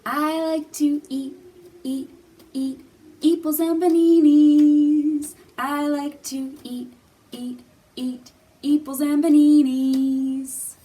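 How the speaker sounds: "eeples and baninis" sung loud, all vowels as long E; vowels swapped to E. Shown as a demonstration. Teacher sings the E-sound verse for kids. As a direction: sing every vowel as EE. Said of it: "replace it with the E sound".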